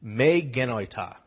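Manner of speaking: "Me genoita" said slowly as meh geh-NOY-tah; Greek phrase. Shown as a demonstration. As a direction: teach "Me genoita" slowly, each syllable clear. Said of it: "I want to teach you a Greek phrase".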